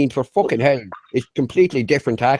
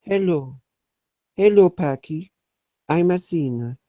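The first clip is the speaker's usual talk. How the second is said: higher, girlish put-on voice; Athena impression; 5 semitones higher than usual. [name] imitating Athena greeting Packy, high put-on voice. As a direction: play a shy woman greeting sweetly. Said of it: "he asked me to do an impression of Athena".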